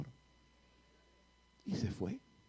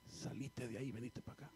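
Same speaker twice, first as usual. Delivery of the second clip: very soft whispered dare; suavecito; 14 dB quieter than usual. Retold or imitated. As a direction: coax it out in a hushed voice. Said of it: "yo le digo suavecito". (reto susurrado, muy bajito)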